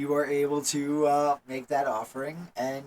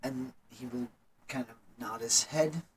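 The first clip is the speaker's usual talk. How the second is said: ordinary talk, nothing marked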